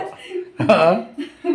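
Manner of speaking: real burst of laughter; amused laugh. Real, not acted. Cook teased about cooking only on Shivaratri, amused laugh. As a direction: laugh out loud at being teased.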